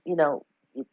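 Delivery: ordinary delivery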